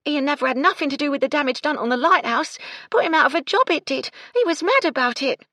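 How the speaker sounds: high, loud, agitated maid defending someone; impassioned servant girl's protest; earnest. A narrator's reading. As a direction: insist on it urgently, pitched right up. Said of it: "Gertie said earnestly".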